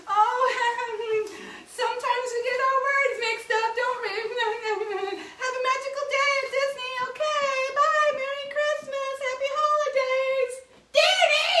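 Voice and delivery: high, bright sing-song cover-up with nervous laughs; chirpy theme park performer; cheery cast member. Acted. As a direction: gush sweetly to the crowd while dragging him off. Cast member smoothing it over.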